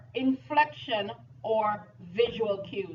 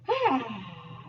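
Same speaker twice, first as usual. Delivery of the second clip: heavy exhale; audible sigh of release; long sigh. Real, not acted. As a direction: let out a long breath. Deep breath after exasperated laughter.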